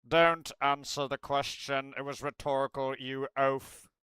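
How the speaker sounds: hammed-up Snape scolding Potter; Snape snapping. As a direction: cut the pupil off with scorn. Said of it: "I think my Alan Rickman is good".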